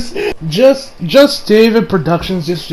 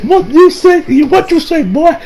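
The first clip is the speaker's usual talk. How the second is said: mock angry retort; 9 semitones higher than usual. Shigechi charges back at Okuyasu.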